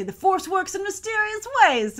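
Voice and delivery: high, knowing, mysterious; mystical sage voice. Retold or imitated. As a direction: intone it like a wise mystic. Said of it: "they would just say".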